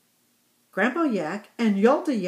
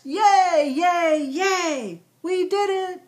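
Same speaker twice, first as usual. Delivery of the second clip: cheering; 9 semitones higher, 4 dB louder, slower than usual. A narrator's reading. Yaks cheering success.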